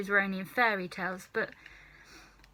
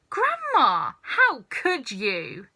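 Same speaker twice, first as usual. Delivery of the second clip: higher cry, then slow; appalled; 4 semitones higher, 5 dB louder, slower than usual. Acted. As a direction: protest in horror. George appalled.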